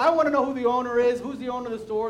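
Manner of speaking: acted angry demand; mad. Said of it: "Now she's mad".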